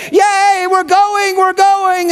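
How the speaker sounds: put-on high, loud, squealing little girl; child shouting with glee; excited child. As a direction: cheer like a thrilled three-year-old. Excited daughter cheering.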